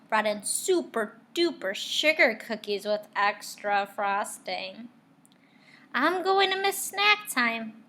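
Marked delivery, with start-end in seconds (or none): 5.9-7.8 put-on child voice.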